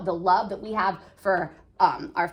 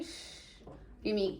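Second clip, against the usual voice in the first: ordinary talk, nothing marked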